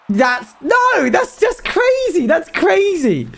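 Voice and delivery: high, drawn-out disbelief; excited disbelief. Real, not acted. Reacting to chat.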